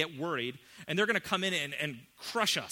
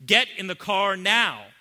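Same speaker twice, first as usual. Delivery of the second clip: loud, slow, flat-out American command; blunt parental order; 5 semitones higher, 12 dB louder than usual. Retold or imitated. American parents order kids into the car, loud and blunt. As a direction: bark it at the kids. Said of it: "Sarah and I would say".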